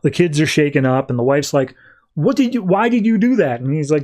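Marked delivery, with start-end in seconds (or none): 2.1-3.6 high, startled questioning; alarmed demand. Retold or imitated. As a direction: act out a shaken wife demanding answers. Wife confronts husband after crash.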